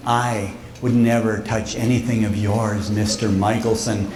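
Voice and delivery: mock innocence, soft and low; innocent bear voice. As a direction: play the sweet bear who swears he's harmless. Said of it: "these innocent little eyes".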